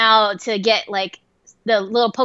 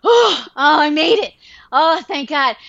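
imitated out-of-breath relief, higher and louder; mock winded arrival; huffing and puffing; 4 semitones higher, 4 dB louder than usual. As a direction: puff like you just rushed back. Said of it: "she's all huffing and puffing like".

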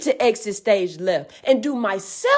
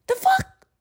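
incredulous scoff. Real, not acted. Scoffing at being paid off.

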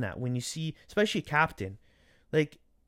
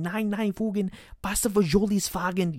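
higher mock German shouting; mock German yelling; 7 semitones higher than usual. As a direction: ham up a German coach yelling on the sideline. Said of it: "yelling and screaming in German".

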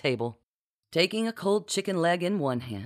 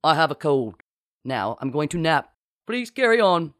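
hasty fake captain; 4 dB louder than usual. A narrator's reading. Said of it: "hastily concluding".

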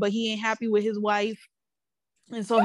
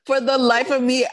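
ordinary delivery

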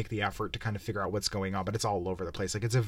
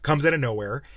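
usual speaking voice

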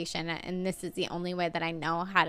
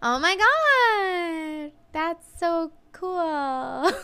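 very high, very slow, fake-sweet gush; customer service voice; 10 semitones higher, 5 dB louder, much slower than usual. Imitating her own fake-nice voice at a double date. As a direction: gush fake enthusiasm, pitched way up. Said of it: "your voice goes up, like, two octaves".

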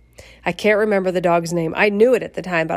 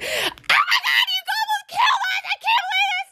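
very high shriek of outrage. Retold or imitated. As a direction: screech it at the driver who nearly killed you. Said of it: "that kinda hurt my throat a little bit".

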